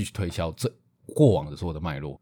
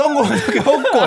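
high, loud, laughing mock shout; mock yell; 11 dB louder than usual. Joking around with a friend, a laughing mock yell. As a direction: bellow it as a joke while cracking up.

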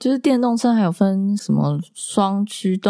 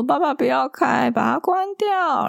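put-on very high child's shriek, slower at the end; imitating a child screaming; 10 semitones higher than usual. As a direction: shriek like a panicked little girl. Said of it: "真的是尖叫说".

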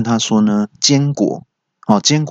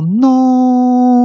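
loud, drawn-out mock yell; 14 semitones higher, 5 dB louder than usual. Horrified employee yells no at the manager's threat, loud and drawn out. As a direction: yell no in horror. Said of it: "就大叫".

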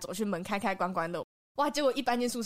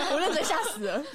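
laughing; 5 semitones higher than usual. Real, not acted. Laughing while retelling fright.

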